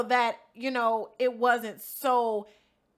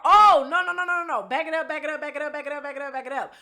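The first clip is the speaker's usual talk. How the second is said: loud outburst into rapid repeated chant; hurried self-correction; 3 semitones higher, 7 dB louder, faster than usual. Real, not acted. Storyteller catching herself ahead of the story, loud rapid backtracking. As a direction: cut yourself off loudly, then rattle off the rewind.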